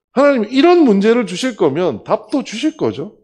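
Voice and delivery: loud, high appeal to God; insistent prayer. Retold or imitated. Director asks God for the solution, insistently.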